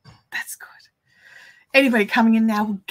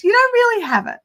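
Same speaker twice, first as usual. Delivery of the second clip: much higher, lightly voiced mum; mum's voice; 11 semitones higher than usual. Mum on the ochre twang, lightly voiced higher. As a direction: quote mum sweetly. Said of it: "And she goes".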